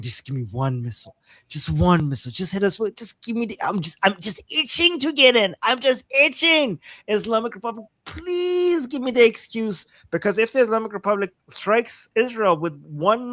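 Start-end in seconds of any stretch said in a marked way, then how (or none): 0.0-9.9 itching to get in, pitch rising as the begging builds; eager pleading. Acted. Israel begging the Islamic Republic for an excuse, eager pleading. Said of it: "like, really hoping".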